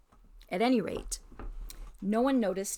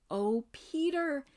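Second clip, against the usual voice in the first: slow, higher amazed exclamation; exclaimed; 7 semitones higher, 4 dB louder, slower than usual. A narrator's reading. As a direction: let the wonder burst out. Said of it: "exclaimed Lucy".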